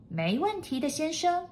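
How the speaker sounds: high-pitched maid's reply; high, willing maid; obliging maid. A narrator's reading. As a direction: agree readily in a high voice. Maid answers master.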